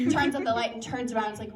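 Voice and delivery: ordinary talk, nothing marked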